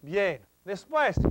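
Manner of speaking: normal talking voice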